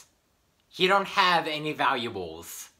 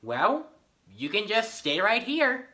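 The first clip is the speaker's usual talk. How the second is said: normal talking voice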